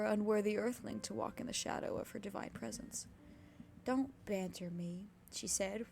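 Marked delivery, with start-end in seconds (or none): 3.8-5.1 slow, hurt plea; a wounded woman pleading gently. A narrator's reading. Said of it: "wounded at what appeared to be his flippancy".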